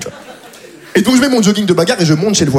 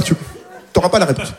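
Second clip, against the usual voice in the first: ordinary delivery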